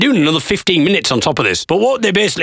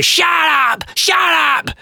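very high, drawn-out mock yell; mock heckler yell; much slower than usual. Crowd cuts off the comedian talking, high mock yell. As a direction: screech it like an impatient heckler. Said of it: "they're all just shouting".

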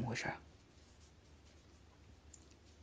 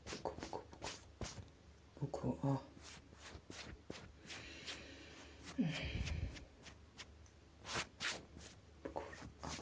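very soft hushing whisper; ASMR whisper; 14 dB quieter than usual. Acted. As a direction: coax her to stop crying. Hushing crying sister.

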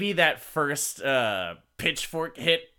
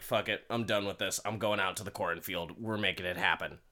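resigned resolve; 6 semitones lower, 7 dB quieter, faster than usual. Retold or imitated. Retold husband giving up, resolved.

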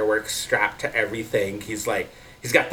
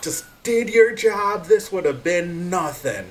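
villain shout; 7 semitones higher than usual. Acted. Villain finishing his rant.

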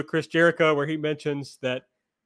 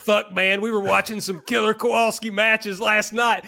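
Southern good-old-boy impression, pitched up; put-on Southern accent; 6 semitones higher than usual. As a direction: play an excited Southern wrestler fanboy. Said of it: "I'm allowed to do the accent".

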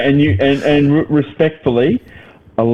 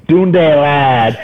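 mock Aussie accent; 5 semitones higher, 5 dB louder, much slower than usual. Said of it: "And now it's all".

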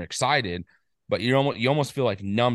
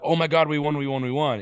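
mock cheering, pitched up; excited celebration; 6 semitones higher, 3 dB louder than usual. Imitating a friend and his wife cheering a winning bet. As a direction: retell the couple cheering their win.

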